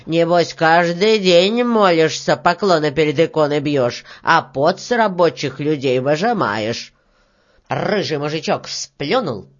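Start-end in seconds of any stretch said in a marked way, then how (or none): 0.1-6.9 loud, low-pitched rebuke; grey-haired man, reproachful. Acted. Grey-haired worker accuses the stingy beetle, reproachful. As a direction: lay into her in a deeper voice.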